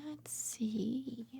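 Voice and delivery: hushed anticipation; whisper. Acted. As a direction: whisper before the reveal. Whispering suspense.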